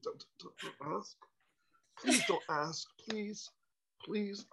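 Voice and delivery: soft, hushed pleading; whispered plea to the maitre d'. Retold or imitated. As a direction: beg in a near whisper. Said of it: "I was like".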